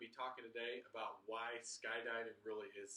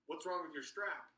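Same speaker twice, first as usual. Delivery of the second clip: distracting question; 6 semitones higher, 6 dB louder than usual. Instructor distracts before jumping.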